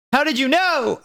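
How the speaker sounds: very high startled question; surprised. Acted. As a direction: jump up high in disbelief. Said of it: "I asked in a surprised way".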